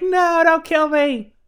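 high, loud, slow mock plea for mercy; begging. Captive woman begs the wildlings not to kill her, hammed-up terrified plea. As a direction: play the captive woman pleading to be spared, drawn out.

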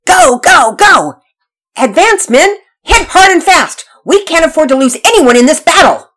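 high, loud commando battle cry; full-volume boy playing army commander; shouting orders. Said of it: "shouted orders as one of his big-muscled commandos".

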